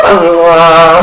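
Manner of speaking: loud startled yelp; startled cry. Retold or imitated. Teacher imitates her startled cry at a loud speaker. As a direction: let out a sudden fright sound. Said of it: "my body just went".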